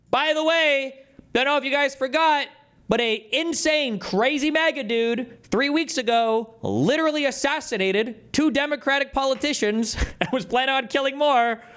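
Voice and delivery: sarcastic squeaky high rant, slowed; mock high voice. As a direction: pitch way up, drag it out mockingly.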